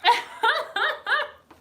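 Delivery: high squealing laugh; a long, high, squealing laugh. Real, not acted. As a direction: burst into laughter. Laughs at 2020 denial.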